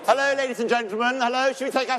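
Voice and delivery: high, loud call to grab the room. Real, not acted. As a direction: project to the back of the hall to get attention. Host calling audience to order.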